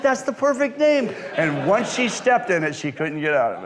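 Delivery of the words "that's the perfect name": high, loud excited overruling; thrilled insistence. Retold or imitated. Husband overrules wife on son's name, thrilled insistence. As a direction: override the objection with delight. He insists over wife's protest.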